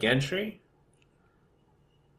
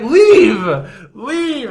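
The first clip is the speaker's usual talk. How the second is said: mock shout; 12 semitones higher, 11 dB louder than usual. Retelling man dismissing guards.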